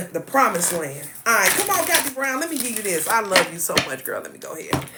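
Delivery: normal talking voice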